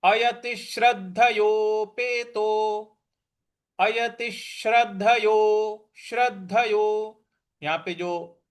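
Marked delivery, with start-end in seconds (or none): 0.0-7.2 अयतिश्रद्धयोपेतो with weight on the द of श्रद्ध; stress on द. Shown as a demonstration. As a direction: lean on द in श्रद्धयो. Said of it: "द है उस पर हमको आघात देना है".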